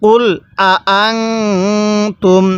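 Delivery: আং held as a nasal hum before তুম; ghunnah nasal hold. Shown as a demonstration. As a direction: hum the noon through the nose. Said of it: "তাহলে কিভাবে পড়ব?".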